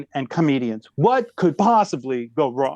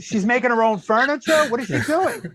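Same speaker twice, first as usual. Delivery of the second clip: incredulous disbelief; 8 semitones higher than usual. Retold or imitated. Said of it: "I'm like".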